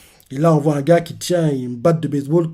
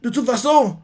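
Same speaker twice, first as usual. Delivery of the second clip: high, tearful despair; 10 semitones higher than usual. Retold or imitated. Young Kuma telling his captors to kill him, tearful. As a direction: say it with tears in your eyes. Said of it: "Il dit ça avec des larmes aux yeux".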